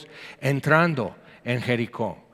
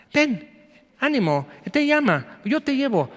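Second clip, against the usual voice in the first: sugary fake kindness; 10 semitones higher than usual. Fake-kind crowd, hypocrites.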